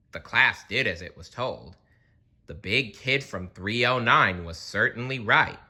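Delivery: usual speaking voice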